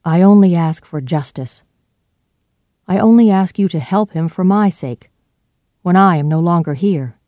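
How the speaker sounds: emphatic, beseeching plea; pleading. A narrator's reading. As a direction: plead with an old friend. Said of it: "pleaded Jekyll".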